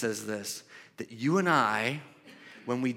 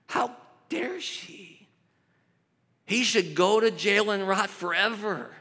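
indignant onlookers; 4 semitones higher, slower than usual. Retold or imitated. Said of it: "people who were there who go".

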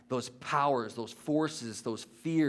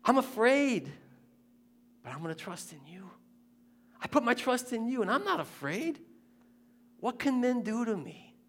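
high-pitched, pleading voice; anguished David; 9 semitones higher than usual. Retold or imitated. Preacher voicing David's distress to God, high and pleading. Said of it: "He's like".

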